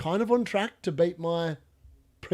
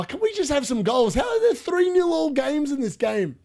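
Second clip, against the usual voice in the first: frustrated shout; 7 semitones higher than usual. Real, not acted. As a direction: yell it in disbelief. Frustrated at goalless games.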